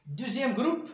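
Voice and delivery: ordinary talk, nothing marked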